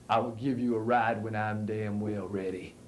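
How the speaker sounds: low, unhurried brush-off; lightly voiced gruff Paris. A narrator's reading.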